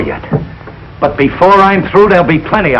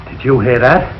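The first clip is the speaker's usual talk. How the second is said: normal talking voice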